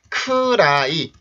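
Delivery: slow, spelled-out "크라이" in three syllables; Korean-style pronunciation. Shown as a demonstration. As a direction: stretch "크라이" with a full 으 after k. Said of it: "우리가 일반적으로 쓰는 크라이가 아니라".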